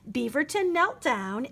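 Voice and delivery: ordinary delivery, nothing marked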